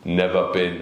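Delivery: low-voiced, ominous dude; creepy Germany fanatic. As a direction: answer low, as the one too devoted fan. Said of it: "one dude who's like".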